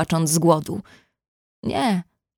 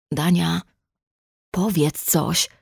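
breaking voice; slower than usual. A narrator's reading. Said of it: "poprosił łamiącym się głosem".

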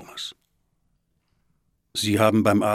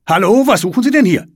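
high, loud confronting shout; calling out; 12 semitones higher, 12 dB louder, faster than usual. A narrator's reading. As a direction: challenge intruders loudly from the door. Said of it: "ruft er". (laut und fordernd rufend)

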